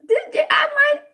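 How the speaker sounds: high, loud cried-out excitement; excited. Retold or imitated. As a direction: burst out with excitement. Excited retold reaction.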